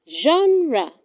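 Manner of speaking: Genre repeated as zhahn-rah; correct pronunciation. Shown as a demonstration. Teacher repeating the model word: Genre as zhahn-rah. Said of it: "It is pronounced genre".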